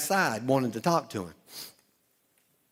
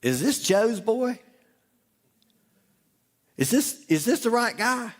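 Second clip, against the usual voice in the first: higher-pitched disbelieving question; amazed townsfolk; 6 semitones higher than usual. Retold or imitated. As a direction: imitate amazed neighbours, pitch lifted. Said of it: "tell you why they were amazed".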